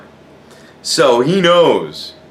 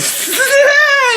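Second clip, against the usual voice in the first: very high burst of laughter; shrieking laugh; 4 dB louder than usual. Real, not acted. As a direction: explode into a squealing laugh. Cracks up at the punchline.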